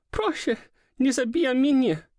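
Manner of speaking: high, pleading cry in Polish; sobbing plea. A narrator's reading. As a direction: beg through sobs. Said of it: "She was sobbing, trembling".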